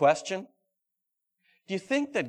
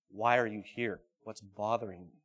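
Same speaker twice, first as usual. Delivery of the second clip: gentle asking; 8 semitones lower, 8 dB quieter than usual. Acted. God gently asks Elijah.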